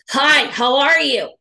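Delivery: loud, slow, gruff hello; booming harsh greeting; rough loud greeting. Shown as a demonstration. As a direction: hit them with a too-loud hello. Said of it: "Tone was rough. Volume is a little loud".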